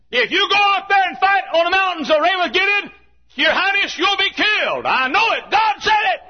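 very high, loud preaching shout; shouted prophecy. Acted. Micaiah warns the king he'll be killed, shouted prophecy. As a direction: proclaim it at full force, high and ringing. Micaiah's warning cried at full force.

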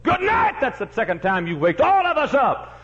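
woken twice and fed up; put-on high voice with a laugh in it; exasperated neighbour. Neighbour complains of second waking.

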